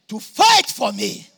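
slow, loud cry of triumph; shouted climax. Retold or imitated. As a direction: burst out with the promise. Quoted believers' climax.

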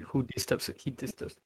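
hushed, breathless ghost-story whisper. Pretend. Teasing friends about a spooky kid, whispered. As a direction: lean in and whisper it like a secret terror.

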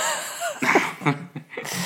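high breathy burst of laughter; caught-out laugh; embarrassed laugh. Real, not acted. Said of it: "Did you just say Jacqueline and Jocko".

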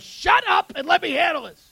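high-pitched acted snap to silence someone; mock fed-up husband; exasperated husband. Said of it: "The husband goes".

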